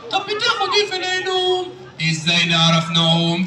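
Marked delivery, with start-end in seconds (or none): 2.1-3.4 slow, very low puppet voice; Aragoz puppet. Acted. Puppet telling the host his name, slow Aragoz voice. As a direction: draw it out as a puppet character. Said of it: "الأراجوز ده وهو بيرغي".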